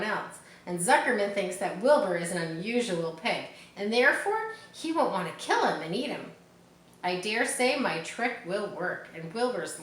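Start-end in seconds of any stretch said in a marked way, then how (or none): none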